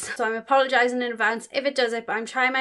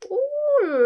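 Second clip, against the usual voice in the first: high-pitched admiring ooh; high, impressed ooh; delighted "ooh"; 4 dB louder than usual. Real, not acted. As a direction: coo high at something sparkly. Reacting to glitter on polish.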